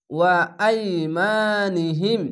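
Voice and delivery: slow, drawn-out melodic chant; Quran recitation. Shown as a demonstration. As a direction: intone it like tajweed recitation. Tajweed recitation of Quran words. (ধীর সুরেলা কুরআন তিলাওয়াত)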